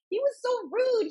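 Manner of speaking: put-on very high-pitched child complaint; indignant kid. Mom imitating son about the pitcher, indignant. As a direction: imitate an affronted little boy.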